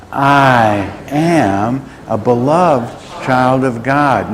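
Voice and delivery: very slow, drawn-out words for a group to follow; leading in unison. Real, not acted. Preacher leads the congregation saying it together, slow and drawn out. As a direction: stretch each word so everyone can join in.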